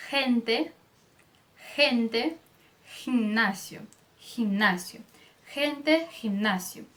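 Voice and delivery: "gente, ginásio" with Spanish h-like G; throaty G. Shown as a demonstration. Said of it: "vai ser pronunciado como".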